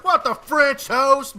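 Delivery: slow, loud, high disbelief; mock exclamation. Retelling his reaction at the store, slow loud disbelief.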